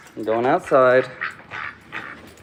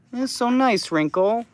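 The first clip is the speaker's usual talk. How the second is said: much higher sing-song cooing; 12 semitones higher than usual. Real, not acted. Owner coos high at Wrinkle the duck. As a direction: squeak it up for the duck.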